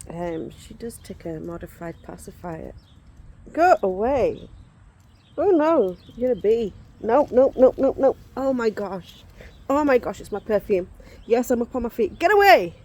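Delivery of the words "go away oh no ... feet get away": loud, high startled yelps at a bee; panicked shouting. Real, not acted. Woman on camera fending off a bee, frightened shouting. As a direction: shoo it off in real alarm. Swatting a bee that attacks her.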